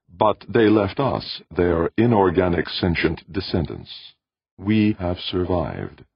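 high, loud, stately tenor, slowing; ancient machine intelligence. A narrator's reading. Machine recounts its makers' extinction to visitor, stately tenor. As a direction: recount it grandly, slowing to the end.

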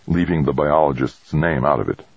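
plain reading voice

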